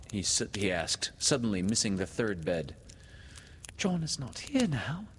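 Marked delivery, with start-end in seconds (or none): 3.8-5.1 high, mildly gasping answer; gasped reply. A narrator's reading. Wendy answers Peter's question about John, gasping. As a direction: answer with a small gasp. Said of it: "she gasped".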